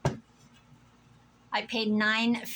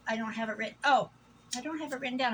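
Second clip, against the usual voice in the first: normal talking voice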